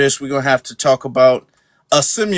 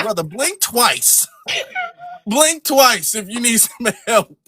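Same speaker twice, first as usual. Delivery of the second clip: slow, higher-pitched mock concern; mock rescue plea; 7 semitones higher, slower than usual. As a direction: draw it out as if secretly signalling a hostage.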